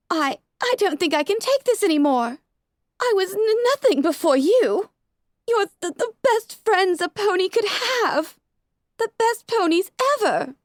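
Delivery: breaking down alone; tearful. A narrator's reading. Rarity crying at mirror.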